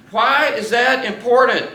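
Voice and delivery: usual speaking voice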